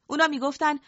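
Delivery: usual speaking voice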